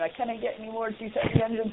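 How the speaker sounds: hint of a hammy engine-room plea; Brad Wright impression. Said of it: "having Brad Wright do the".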